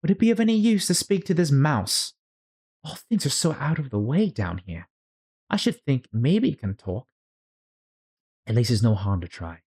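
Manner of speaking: put-on high, curious girlish voice; wondering aloud like a young girl; Alice voice. Alice wondering about the mouse, high and curious.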